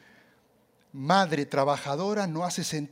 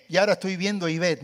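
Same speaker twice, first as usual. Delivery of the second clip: normal talking voice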